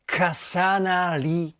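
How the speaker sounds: 重なり with hard, stretched K and S; 重なり drawn out loud, K and S bursting as in English; English-style consonants. Shown as a demonstration. Music reactor showing how the group sings, English-style consonants. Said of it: "KとかSの発音っていうのが".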